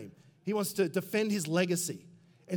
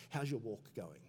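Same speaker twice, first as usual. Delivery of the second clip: mock churchy question; 5 semitones lower, 10 dB quieter than usual. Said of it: "we kinda talk like this about your walk".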